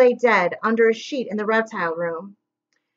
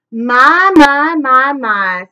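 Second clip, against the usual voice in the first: drawn-out mocking my, my, my; sly villain; 2 semitones higher, 8 dB louder, much slower than usual. A narrator's reading. Villain Stefano greeting the orphans slyly, mock surprise. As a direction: purr it like a smug villain.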